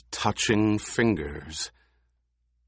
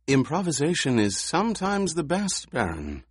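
smooth, high, oily insinuation; silky insinuating voice; 7 semitones higher than usual. A narrator's reading. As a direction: purr it in slyly at his ear. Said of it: "That silky, insinuating voice".

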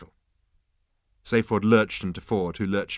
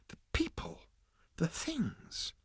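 hushed awe; 7 semitones higher, 12 dB quieter, slower than usual. Acted. Arthur awestruck, hushed.

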